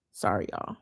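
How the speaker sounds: low, soft, tearful apology; a real catch in the voice; choked up. Real, not acted. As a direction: pull yourself together through tears. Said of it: "I'm gonna get emotional".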